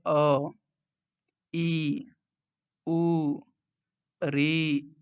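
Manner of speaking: "अ, ई, उ, री" sounded singly with pauses between; short vowel demonstration. Shown as a demonstration. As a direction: give "अ, ई, उ, री" as separate model sounds. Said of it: "कम समय लगता है".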